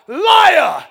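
loud, high, drawn-out accusing yell; imitated holler. Opposing preacher heckling a Bible reader, imitated holler. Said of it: "he hollered out".

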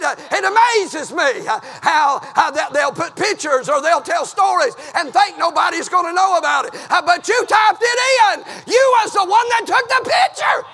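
rising to a full-force preacher's shout; country preacher climbing to a shout; preaching. Acted. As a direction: build from fervent to hollering.

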